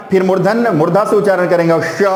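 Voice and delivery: ordinary talk, nothing marked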